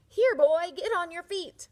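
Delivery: loud, high shout. Acted. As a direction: yell at the sleeping gate boy. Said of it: "so he shouted".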